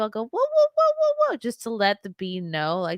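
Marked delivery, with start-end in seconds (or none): 0.2-1.4 very high mock barking; string of high-pitched pretend woofs; imitated dog bark. Parent barking so baby knows it's normal, high playful woofs. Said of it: "sometimes I'll bark too".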